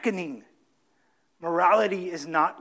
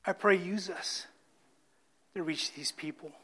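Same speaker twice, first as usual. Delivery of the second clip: closing prayer; 3 semitones lower, 4 dB quieter than usual. Acted.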